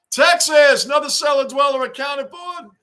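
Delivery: high, loud hyped-up shout-out; shout with cracking voice. Real, not acted. Streamer welcoming a viewer from Texas, cracking shout. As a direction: belt it out till the voice cracks. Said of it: "My voice is cracked there".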